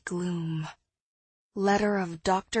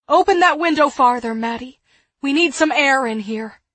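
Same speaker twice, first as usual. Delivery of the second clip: loud, high, pressing command; urgent order; 4 semitones higher, 12 dB louder than usual. A narrator's reading. Woman ordering air for sick boys, loud and urgent. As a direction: give frantic orders, voice raised.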